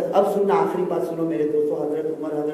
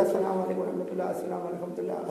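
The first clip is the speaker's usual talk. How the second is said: soft prayer salutation; 8 dB quieter than usual. Acted. Acting out salutation ending prayer.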